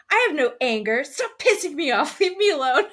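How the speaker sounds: loud, rising hammed-up rage; mock angry shouting. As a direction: imitate yelling while denying any anger. Acting out angry denial of anger.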